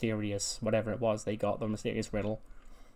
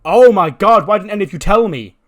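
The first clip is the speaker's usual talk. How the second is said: high, very loud, rushed wail of dismay; horrified outburst; 9 semitones higher, 14 dB louder than usual. Acted. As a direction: cry out in sudden mortified realization. Manny horrified on realizing.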